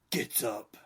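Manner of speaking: put-on gruff low Irish growl, Irish accent; a stern Irish footballer, Irish accent. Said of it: "in his Irish accent".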